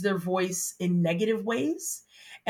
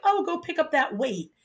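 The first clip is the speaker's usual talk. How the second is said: casual breezy ask; 8 semitones higher than usual. Retold or imitated. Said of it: "I was like".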